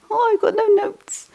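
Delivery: high, squeaky little wail of alarm; mock panic. Teacher joking to listeners about teaching without notes, mock panic.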